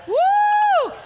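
high forced laugh into loud whoop; demonstrated laugh. Shown as a demonstration. Preacher assaulting strongholds with laughter, whooping laugh. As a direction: laugh in attack, then whoop.

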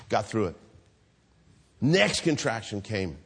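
ordinary delivery

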